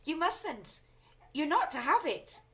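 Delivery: high, urgent warning; urgent boy. A narrator's reading. George refusing grandma. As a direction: warn her off quickly.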